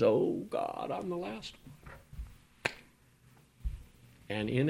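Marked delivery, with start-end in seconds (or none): 0.0-2.7 whispered, slow, drained of hope; a broken man gives up; despairing whisper. Acted. Elijah despairing in the wilderness.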